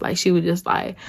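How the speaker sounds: ordinary talk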